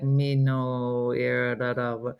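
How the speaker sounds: put-on low, monotone girl who doesn't wanna be doing this; imitating a bored, affectless language-app girl; flattest affect. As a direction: drone it with zero interest. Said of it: "She has the flattest affect".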